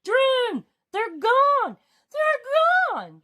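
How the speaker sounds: high, loud despairing cries; mournful shouts. Acted. King finds the closet empty, shouting high and loud. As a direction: wail his name high and loud. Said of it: "King's mournful shouts".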